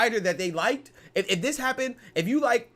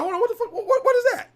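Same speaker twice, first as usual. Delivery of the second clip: high, alarmed mock double-take; shocked reaction; 4 dB louder than usual. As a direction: jolt up in pitch like something was slipped in your mouth. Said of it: "I'll be like".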